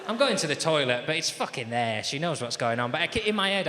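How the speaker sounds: normal talking voice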